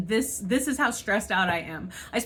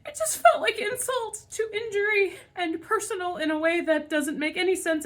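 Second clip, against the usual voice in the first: laugh-crying; 8 semitones higher than usual. Real, not acted. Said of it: "I'm legitimately gonna cry".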